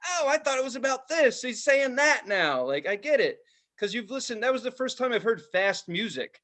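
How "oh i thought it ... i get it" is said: mock epiphany. Listener having epiphanies, high mock surprise. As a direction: act out the lightbulb moment. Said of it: "You're like".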